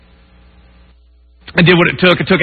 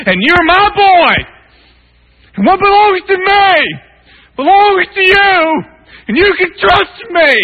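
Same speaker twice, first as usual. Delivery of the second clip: voice of God; slower than usual. Pretend. God speaking to his heart.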